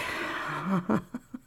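quick nervous laugh; breathy laugh. Acted. Laugh in scene.

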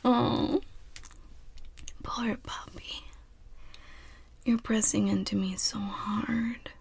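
soft teasing whisper. Acted. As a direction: breathe it close to the ear.

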